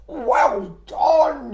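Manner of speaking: a big frog cheering loudly. Acted. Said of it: "he cheered".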